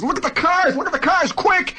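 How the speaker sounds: high excited shout, pointing down; eager dad urging look at the cars; father calling out. Retold or imitated. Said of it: "my father says".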